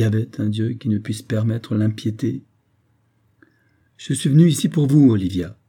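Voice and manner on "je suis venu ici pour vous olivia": blurted out suddenly, higher and louder; sudden outburst. A narrator's reading. Edward abruptly confronting his cousin Olivia, blurting. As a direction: burst out with it. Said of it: "dit tout à coup".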